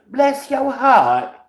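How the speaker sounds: very high, slow, sugary mock Southern lady; mock sweet Southern. Mocking Southerners' fake politeness, very high and slow. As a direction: sing it out like a falsely sweet church lady. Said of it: "y'all say".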